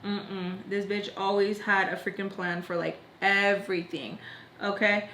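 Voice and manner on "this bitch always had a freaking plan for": usual speaking voice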